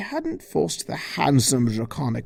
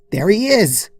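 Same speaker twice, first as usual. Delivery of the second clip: higher and louder, triumphant; pointing him out; 8 semitones higher, 6 dB louder than usual. Acted. Twilight spotting Discord in the meadow, triumphant call.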